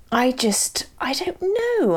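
whispered, breathy and high; whispering. Real, not acted. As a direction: drop to a whisper.

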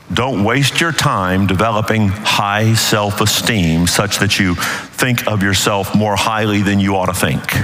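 usual speaking voice

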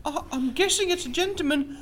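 lady voice. Acted. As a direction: play the flustered lady witness.

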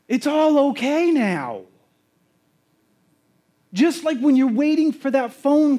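high, loud, slow preaching; preaching at full force. Acted. Preacher crying out to the church, impassioned. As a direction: shout the good news to the room.

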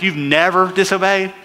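ordinary delivery

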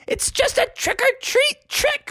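very high playful witch voice; witchy mom. Acted. Mom explains her trick to Froggy, high and witchy. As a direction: squeal it like a teasing witch. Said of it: "she was like".

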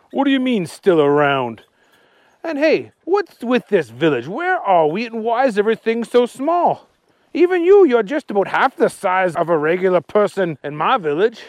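lively puzzled Archie voice. Acted. Archie telling Agnes the town is strange, puzzled. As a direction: wonder aloud as Archie.

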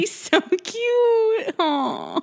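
high, drawn-out gushing; melting over something adorable; gushing coo. Retold or imitated. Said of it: "And I'm like".

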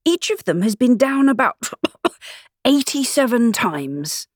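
high voice interrupted by coughing; choking voice. A narrator's reading. Hatter reporting on the fight, choking on bread. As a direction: cough mid-sentence, then drag out the rest. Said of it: "he said in a choking voice".

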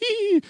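very high, thin "hee"; high-pitched pretend giggle squeal; mock tickled squeal. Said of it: "tickling him with a feather and he's like".

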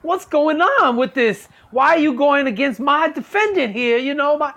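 high-pitched cheeky lawyer voice; mock lawyer. Pretend lawyer asking the judge why, in a high mock voice. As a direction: argue in court like a casual friend. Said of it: "be like".

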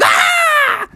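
very high, drawn scream; frightened scream. Acted. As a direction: shriek as you flee.

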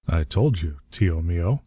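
usual speaking voice

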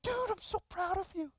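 high, gushing praise; proud papa. Retold or imitated. Pastor praises the man who tithed, proud papa gushing. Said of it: "just like a proud papa".

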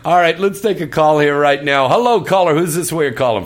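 loud, high announcer voice taking a call; radio host call-in. Acted. Host greeting the caller on air, loud announcer voice.

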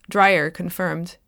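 ordinary delivery

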